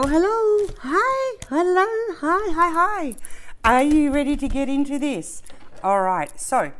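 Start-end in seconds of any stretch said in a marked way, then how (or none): 0.0-5.4 squeaky high hello hello; chirpy sing-song hello; high excited greeting. Real, not acted. Greets viewers with camera turned.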